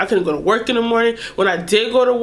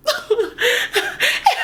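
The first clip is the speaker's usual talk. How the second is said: high squealing laugh. Real, not acted. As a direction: burst out laughing. Laughing at memory.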